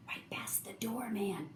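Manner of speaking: sneaky storytelling whisper; hushed whisper. A narrator's reading. Narrator tells of animals sneaking past, in a whisper. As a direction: drop to a secretive whisper.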